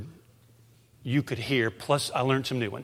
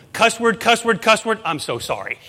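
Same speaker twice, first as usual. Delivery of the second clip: higher, louder imitation of a sheepish golfer; mock sorry golfer; 8 semitones higher, 5 dB louder than usual. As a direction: blurt out curses, then apologize in a half-hearted mock voice. Golfers cursing then apologizing.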